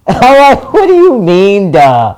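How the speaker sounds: high, loud laughing disbelief. Real, not acted. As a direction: laugh it out in happy shock. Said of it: "I was just laughing".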